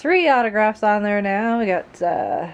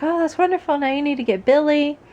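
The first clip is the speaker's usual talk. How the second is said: high-pitched, gushing quote; Jenna Coleman; 8 semitones higher, 4 dB louder than usual. Retold or imitated. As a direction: imitate an enthusiastic actress giving instructions. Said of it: "but she's like".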